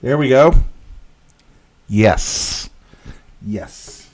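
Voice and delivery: a burst of real satisfaction; pleased. Real, not acted. Delighted when the painting works.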